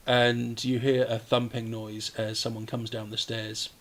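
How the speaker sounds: ordinary talk, nothing marked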